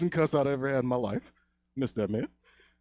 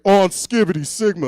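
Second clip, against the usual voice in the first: put-on raised, louder kid-brother cry; mock kid's yell; 6 semitones higher, 8 dB louder than usual. Little brother overheard from downstairs, mock yell. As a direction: call it out high and loud, imitating a kid.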